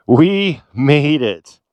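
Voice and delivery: very slow, drawn-out victory cry; savouring triumph. Real, not acted. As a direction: stretch out each word to savour it. Repeats it, savouring the arrival.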